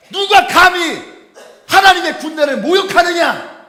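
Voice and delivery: loud, high defiant cry; booming challenge to the army; bold challenge. Retold or imitated. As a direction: proclaim it like a fearless boy. David boldly challenging Goliath.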